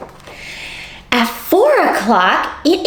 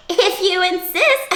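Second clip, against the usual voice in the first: high cackling laugh around the words; eerie hyena laugh. Acted. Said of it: "laughing eerily".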